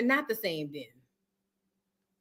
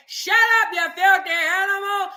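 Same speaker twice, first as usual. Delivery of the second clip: mock yell; 7 semitones higher, 4 dB louder than usual. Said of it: "people saying".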